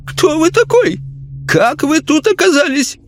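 high, outraged lady's voice; indignant lady. A narrator's reading. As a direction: scold the intruder. Lady spirit speaks.

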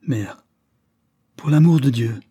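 cri implorant, plus haut et fort sur « l'amour »; exclamation suppliante. Acted. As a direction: implorer sa mère d'un cri. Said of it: "s'écria Lord Louis". (Lord Louis implore sa mère d'un cri)

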